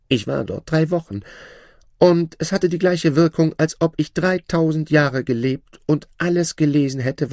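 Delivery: high, proud voice of a boastful shadow; shadow's voice. Acted. Shadow bragging to his old master, high voice. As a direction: lift the voice high and show off new wealth.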